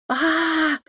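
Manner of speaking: high, loud scream of fright; sustained high shriek, caught off guard; startled scream. A narrator's reading. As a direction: cry out in sudden surprise. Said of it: "От неожиданности закричала Маша".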